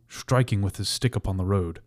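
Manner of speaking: ordinary delivery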